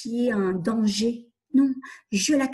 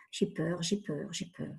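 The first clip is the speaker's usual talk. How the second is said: each repeat softer until almost gone; fading repetition; 5 semitones lower, 10 dB quieter than usual. Shown as a demonstration. Coach names her fear, softer each time. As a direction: let it die away.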